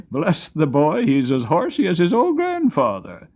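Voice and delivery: a proud grandfather laughing at his grandson; amused old grandfather. Acted.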